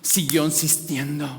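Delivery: ordinary talk, nothing marked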